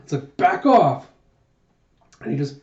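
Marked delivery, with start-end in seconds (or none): 0.4-1.1 raised, louder warning; acted angry shout. Huck fending off Jayha before a punch, acted angry shout.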